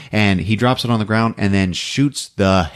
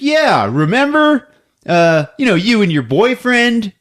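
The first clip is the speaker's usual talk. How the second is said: chummy detective; 10 semitones higher, 3 dB louder than usual. Retold or imitated. Host voicing the detective teasing Kirsty about her boyfriend. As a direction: play the detective far too friendly. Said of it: "He's like".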